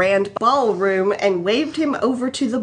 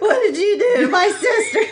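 high indignant demand, nearly laughing; hammed-up scandalised brother; mock outrage; 10 semitones higher than usual. Luke confronting Han about his sister, high mock outrage.

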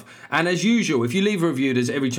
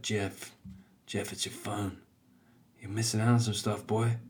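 sly hushed phone voice; 3 semitones lower, 8 dB quieter than usual. Acted. Phone calling to its owner.

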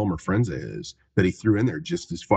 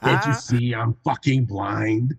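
slow, higher-pitched put-on voice with laughter; mock movie-character outburst; 4 semitones higher, slower than usual. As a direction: quote it hammed-up and drawn out. Acting out movie line, laughs.

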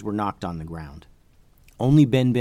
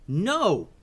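yelled refusal; 7 semitones higher than usual. Acted. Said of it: "yelled Kavi".

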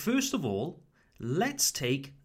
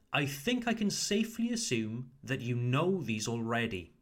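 ordinary talk

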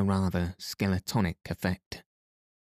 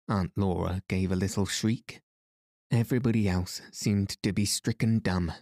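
ordinary delivery

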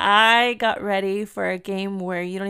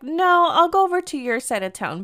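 higher-pitched, insistent retort; quick to refuse, pitched up and insistent; firm counter-offer; 7 semitones higher, 4 dB louder than usual. Retold or imitated. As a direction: jump in high to overrule the offer. Her firm refusal of his offer.

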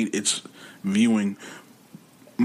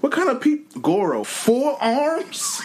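pitched way up, baffled and disbelieving; incredulous mock voice; much slower than usual. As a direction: play the stunned official who can't believe the roster. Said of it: "He goes".

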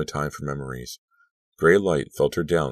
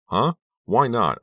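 high, loud, incredulous question; angry demand; 8 semitones higher, 6 dB louder, slower than usual. A narrator's reading. Girl told they cannot switch back, angry high demand. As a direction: burst out demanding a reason. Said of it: "I demanded angrily".

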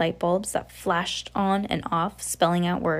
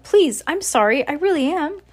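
put-on high, louder girl's plea; pleading apology; 9 semitones higher, 5 dB louder than usual. A narrator's reading. Coraline apologises to the offended cat, high and pleading. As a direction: beg forgiveness, voice up high.

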